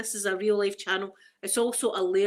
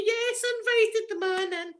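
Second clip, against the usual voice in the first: mock meek admission; 13 semitones higher than usual. Imagining answering in court, high mocking voice. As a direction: chirp it like a silly witness.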